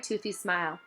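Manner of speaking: plain reading voice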